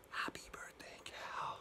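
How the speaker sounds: hushed whisper. Acted. Storyteller repeating the birthday cheer to kids, whispered. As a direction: whisper it very softly.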